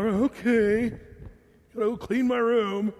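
slow, dragged-out sulky kid imitation; grudging. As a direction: mimic reluctant obedience, stretching the words. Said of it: "that's gonna be their heart forevermore".

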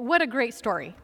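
ordinary talk, nothing marked

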